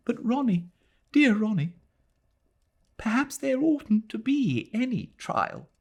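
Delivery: put-on a girl begging her fiancé; pleading young woman. A narrator's reading. Adela pleading, high voice.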